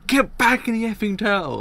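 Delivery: loud high yell across the street; imagined shout. Retold or imitated. A stranger on the street yelling at someone, loud and high. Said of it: "walking down the street and hear someone".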